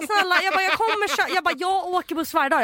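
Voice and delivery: retelling begging the bus driver; pleading self. Retold or imitated.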